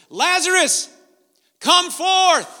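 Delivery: high, loud call into the tomb; ringing summons to the dead; commanding call. Retold or imitated. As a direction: call Lazarus out with full command. Jesus calling Lazarus from grave.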